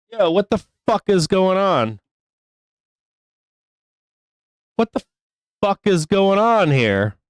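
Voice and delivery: angry. Real, not acted. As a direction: demand to know what's going on, angrily. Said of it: "fucking angry right now".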